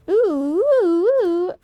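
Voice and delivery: high, loud delighted oohs; gleeful loot cry; excited squeal. Real, not acted. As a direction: squeal over shiny upgrades.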